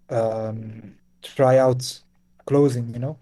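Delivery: usual speaking voice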